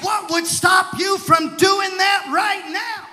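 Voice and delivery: loud, driving preacher's challenge; emphatic preaching. Acted. Preacher challenges a man on the street to be baptized, emphatic. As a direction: press the question home like a preacher.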